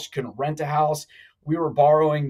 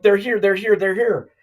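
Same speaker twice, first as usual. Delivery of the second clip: retold yelling; 6 semitones higher, 4 dB louder than usual. Wife yelling FBI arrived.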